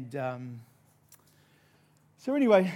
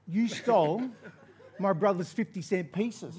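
louder, higher mock accusation; acted accusation; 5 semitones higher, 6 dB louder than usual. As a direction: confront the culprit in mock anger. Acted confrontation at school.

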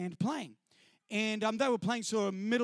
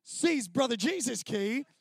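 normal talking voice

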